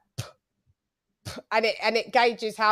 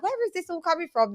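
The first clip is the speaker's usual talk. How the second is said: higher-pitched, puzzled retelling; confused; 8 semitones higher, 5 dB quieter than usual. Retold or imitated. Retelling own reaction to a co-host, confused. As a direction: act out being baffled, pitched up. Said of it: "I was confused".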